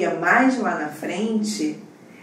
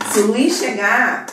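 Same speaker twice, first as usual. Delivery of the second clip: ordinary delivery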